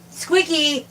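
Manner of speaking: high, falling call from a distance; calling the cat. Real, not acted. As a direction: call a naughty cat by name.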